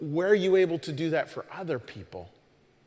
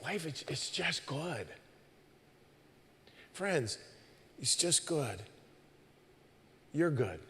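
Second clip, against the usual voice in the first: soft and tender; 8 dB quieter than usual. Real, not acted. Tender aside to congregation.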